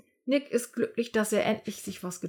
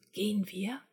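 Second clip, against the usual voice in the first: a much softer, hushed question; whispered; 7 dB quieter than usual. Acted. Nick quietly asks his friend Bulli to leave, whispering. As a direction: lean in and ask under your breath. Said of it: "Nick flüstert Bulli zu".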